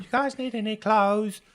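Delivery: high, cheery tailor call. Acted. Tailor calling after the fleeing party, high voice. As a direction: call out like a chirpy shopkeeper.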